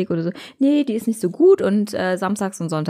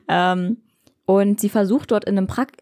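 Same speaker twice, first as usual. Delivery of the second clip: normal talking voice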